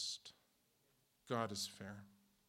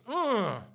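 disgusted ugh; 11 semitones higher, 14 dB louder than usual. Retold or imitated. Disgust after insults.

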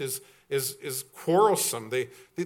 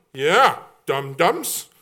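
slow, louder sneer at dum-dums; mock disdain; 7 dB louder, much slower than usual. Preacher mocking city snobs' view of farmers. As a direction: drag it out with scorn.

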